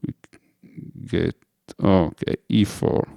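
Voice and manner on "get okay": ordinary delivery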